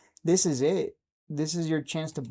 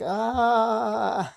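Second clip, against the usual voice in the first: mock panic; 7 semitones higher, 4 dB louder than usual. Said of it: "everyone else is like".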